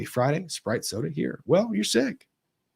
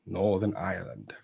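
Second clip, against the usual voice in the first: badly attempted Northern Irish lilt, lower and softer; half-hearted Northern Irish accent; terrible Northern Irish accent; 4 semitones lower, 6 dB quieter than usual. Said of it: "That was a terrible accent".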